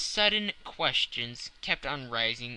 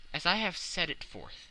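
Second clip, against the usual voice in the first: plain reading voice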